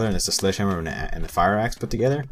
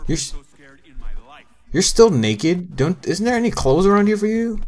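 shocked; 11 semitones higher, 6 dB louder than usual. Real, not acted. Shocked at naked survivor.

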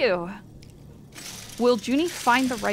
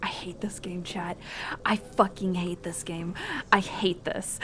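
soft, half-whispered grumble; muttered frustration; 4 semitones lower, 9 dB quieter than usual. Real, not acted. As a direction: grumble it very softly under your breath. Muttered gaming frustration, under breath.

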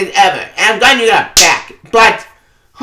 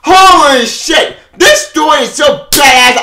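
slow, higher and louder amazed outburst; excited shout; 8 semitones higher, 4 dB louder, slower than usual. Real, not acted. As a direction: let the amazement burst out. Hyped reaction to the story.

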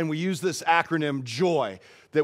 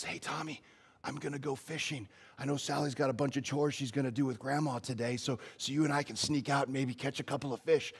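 much softer secret invitation; conspiratorial hush; 10 dB quieter than usual. Retold or imitated. Grandpa quietly inviting Tommy to sneak out fishing, hushed.

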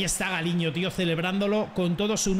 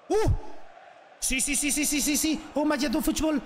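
excited shouting; 8 semitones higher than usual. Real, not acted. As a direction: scream it as the goal comes. Goal about to happen.

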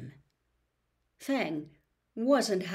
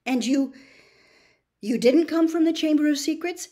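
high, quick and nervous; nervous question; 7 semitones higher, 6 dB louder, faster than usual. Acted. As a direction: ask fast in fear. Harry afraid asking.

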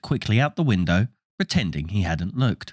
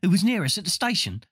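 put-on young boy voice; 11 semitones higher than usual. Young twin voiced in story.

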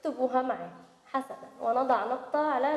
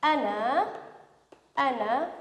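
normal talking voice

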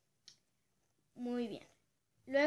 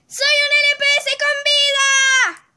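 full-throated high shout, slow and drawn out; shouting out the window; 14 semitones higher, 13 dB louder than usual. Pretend. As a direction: yell it out the window at full volume. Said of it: "Te reto a que grites por la ventana". (grito a todo pulmón por la ventana)

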